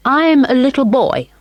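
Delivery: put-on a small boy's polite voice. A narrator's reading. William voiced.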